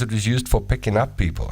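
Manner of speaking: usual speaking voice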